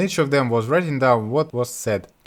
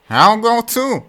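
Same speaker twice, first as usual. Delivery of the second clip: rough, slow, higher mocking yokel voice; Butthead voice; 9 semitones higher, 7 dB louder, slower than usual. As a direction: sneer it like the village fool. Butthead insists on going.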